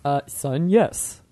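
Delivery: low, soft mock-father voice; playing the dad. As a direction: answer your son as a deep-voiced dad. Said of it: "Heather, you're the dad".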